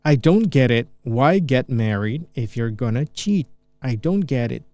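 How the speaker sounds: slow, clear teacher read; slow careful demo of the line; slow repeat of the clip. Shown as a demonstration. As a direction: spell it out slowly for learners. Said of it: "ada pengucapan".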